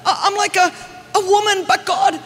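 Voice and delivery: whiny frantic begging; panicked pleading. Retold or imitated. Praying in Greece, high hammed-up panic. Her past panicked prayer.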